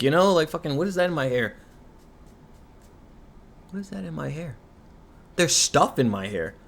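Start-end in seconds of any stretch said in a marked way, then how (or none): 3.7-4.6 much softer, almost to herself; a quiet, bemused aside; puzzled undertone. Real, not acted. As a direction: mutter it while picking at your hair.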